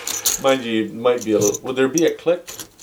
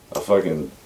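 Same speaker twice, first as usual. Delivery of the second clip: ordinary delivery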